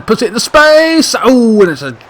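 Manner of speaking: high loud cry; loud peak commentator call; excited shout. Real, not acted. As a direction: yell as the chance opens. Chance in live commentary.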